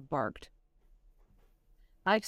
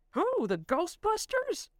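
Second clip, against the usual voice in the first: sarcastic; 12 semitones higher, slower than usual. Acted. Sarcastic question.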